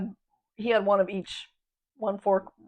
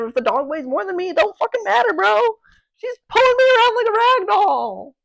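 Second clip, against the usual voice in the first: high, loud and worked up; exasperated outburst; 13 semitones higher, 10 dB louder than usual. Real, not acted. Pet sitter ranting to viewers, loud and exasperated. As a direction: yell it out, fed up with the dog. Describing being dragged by dog.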